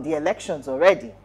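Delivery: ordinary delivery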